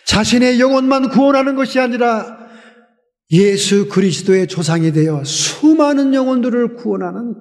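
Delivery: usual speaking voice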